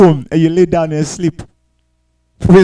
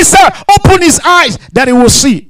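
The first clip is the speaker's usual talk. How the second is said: high, emphatic bold request; raised, assured preacher's voice; confident; 7 semitones higher than usual. Retold or imitated. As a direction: declare it with full confidence. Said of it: "He had confidence and he said".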